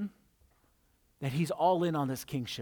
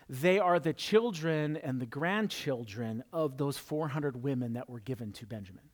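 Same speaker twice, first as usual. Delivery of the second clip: ordinary talk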